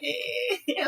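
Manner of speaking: very high-pitched delighted squeal of words; hammed-up falsetto enthusiasm; mock excitement. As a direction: gush like a thrilled movie fan. Acting own thrilled reaction to film.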